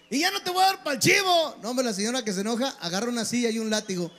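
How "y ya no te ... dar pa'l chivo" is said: high, stretched defiant yell; grito bravucón. Acted. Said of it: "usted grítele". (amenaza gritada, aguda y alargada)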